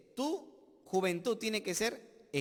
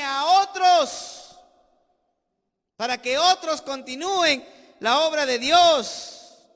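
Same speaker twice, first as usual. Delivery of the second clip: slow, louder and higher; preaching climax; 4 semitones higher, 11 dB louder, slower than usual. Real, not acted. Pastor driving home Paul's charge, slow and loud. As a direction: stretch out a otros like a pulpit cry.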